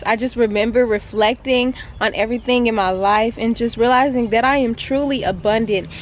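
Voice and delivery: ordinary talk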